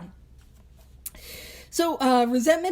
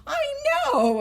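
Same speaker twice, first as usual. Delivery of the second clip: drawn-out, higher knowing sigh; mock knowing group; slower than usual. Imitating members in the meeting, slow and high. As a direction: play the weary know-it-all, slowly. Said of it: "some of you are like".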